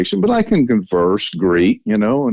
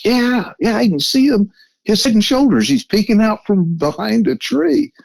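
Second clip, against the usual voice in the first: voicing the friend high and eager, much higher; excited whisper-high friend, pitched way up; excited friend voiced; 12 semitones higher than usual. To Matt, retelling his friend spotting the creature, high eager voice.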